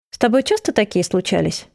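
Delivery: ordinary delivery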